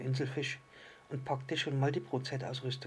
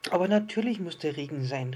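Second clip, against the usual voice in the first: raised, emphatic storybook exclamation; calling out; 4 semitones higher, 6 dB louder than usual. A narrator's reading. Island fish answers the little star about rain, calling out. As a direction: call it out a little louder and higher. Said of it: "rief der Inselfisch".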